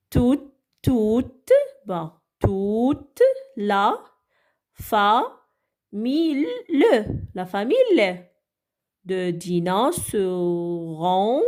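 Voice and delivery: slow halting read-aloud, sounding out; lecture syllabée lente. Shown as a demonstration. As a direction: read it slowly as if deciphering word by word. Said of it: "est-ce que vous pouvez m'aider à lire cette phrase". (lecture hésitante et lente)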